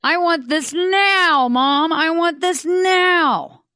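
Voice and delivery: high, slow, loud bratty wail; whining child. Retold or imitated. Child at the store demanding something from mom, loud whiny wail. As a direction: wail it like a kid demanding a toy. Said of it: "it's more like".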